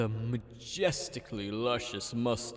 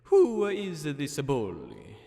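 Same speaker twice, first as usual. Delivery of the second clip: put-on Italian accent; 5 semitones higher than usual. Mario voiced in story.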